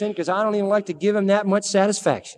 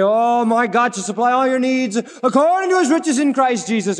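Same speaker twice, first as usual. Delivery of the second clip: higher, louder hammed-up pious recital; mock pious recital; 5 semitones higher, 3 dB louder than usual. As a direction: rattle off the verse like empty piety. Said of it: "We say".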